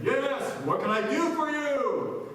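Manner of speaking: loud, high, slow heavenly call; booming voice from above. Acted. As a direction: boom it out slowly from on high. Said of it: "this booming voice from above saying".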